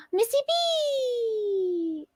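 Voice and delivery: high and very slow, stretching the name out; delighted drawn-out name. Real, not acted. Streamer greeting a donor by name, high, slow and delighted.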